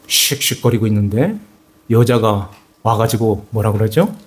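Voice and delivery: normal talking voice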